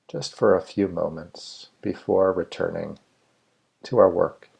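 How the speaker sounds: measured meditation guide with long, spacious pauses; guided meditation. Real, not acted. Meditation leader inviting listeners to settle and let go, guided meditation. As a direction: lead listeners unhurriedly, phrase by phrase, leaving room to breathe.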